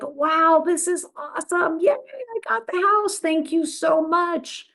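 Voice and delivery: high, thrilled client voice; gushing client thanks. Acted. Client thanking her agent, high and thrilled. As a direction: gush like a delighted new homeowner.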